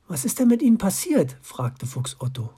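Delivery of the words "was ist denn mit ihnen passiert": put-on anxious child voice. A narrator's reading. Worried fox child asks.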